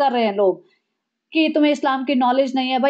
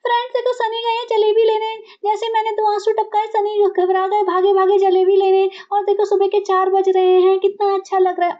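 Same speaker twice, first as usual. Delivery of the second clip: high, gushing vlog voice; fake-sweet show for the camera; mock vlogger wife; 6 semitones higher than usual. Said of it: "बहू ने तो इतनी नौटंकी मचा दी". (ऊँची, इतराती व्लॉग वाली आवाज़)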